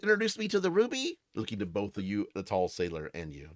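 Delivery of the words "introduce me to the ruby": put-on high, fast childlike voice; eager little boy; little kid voice. Short sailor kid voiced.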